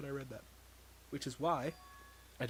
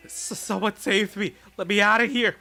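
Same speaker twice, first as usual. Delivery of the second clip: loud, higher, stammering cry for help; panicked scream; 8 semitones higher, 11 dB louder than usual. Acted. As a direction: scream to be let out.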